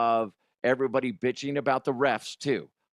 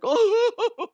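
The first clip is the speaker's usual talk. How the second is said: high, loud drawn-out mock wail; hammed-up cry of protest; 6 dB louder than usual. Mocking how everything upsets the player, mock wail. Imitates player's complaining reaction.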